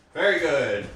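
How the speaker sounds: ordinary delivery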